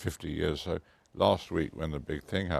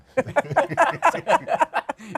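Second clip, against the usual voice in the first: a sudden high burst of loud laughter; big laugh; 11 dB louder than usual. Real, not acted. Joke about playing Cordelia.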